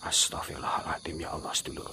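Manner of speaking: hushed unvoiced prayer; frightened whisper. Real, not acted. Host whispers a prayer to viewers after the sighting. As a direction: breathe the prayer out in fear.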